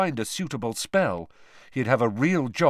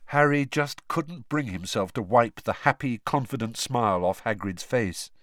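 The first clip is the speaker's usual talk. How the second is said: usual speaking voice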